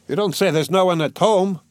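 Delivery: bright, carrying call up the stairs; cheerful ringing cry. A narrator's reading. Newcomer calling to the first visitor, cheerful ringing voice. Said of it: "cried in a cheerful, ringing voice".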